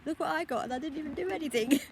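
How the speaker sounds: high-pitched imitation of a child; mimicking a thrilled small child; excited little boy. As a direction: pipe up like a kid showing off a present. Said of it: "he come pounding up to me".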